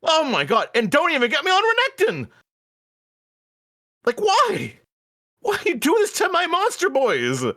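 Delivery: high, outraged whining rant; exasperated rant. Real, not acted. Fan ranting to friend about ruined characters, high outraged whine.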